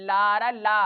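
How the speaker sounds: ला रा ला called loud, stressing the ला; sounding out the first syllable. Shown as a demonstration. Teacher showing the ला sound in लाइब्रेरी, loud. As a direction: punch the ला sound. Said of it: "ला उच्चारण है इसका".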